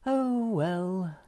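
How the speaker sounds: high, resigned sighing voice; sighed. A narrator's reading. Moomin Mama gives up on the philosopher, sighing. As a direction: sigh it out high, giving in. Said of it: "sighed Moomin Mama".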